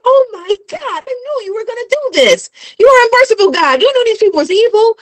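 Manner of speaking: fast, high-pitched exasperated complaint; mock angry outburst. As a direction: act out someone griping furiously at God. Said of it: "He was mad".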